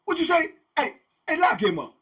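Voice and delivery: loud, high angry warden; warden yelling. Retold or imitated. Warden threatening an inmate with jail, loud and high. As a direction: snap back loud and sharp. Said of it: "that's how he coming".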